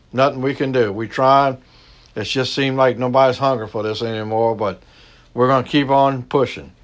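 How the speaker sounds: hushed pastor's prayer. Acted. Leads a closing prayer.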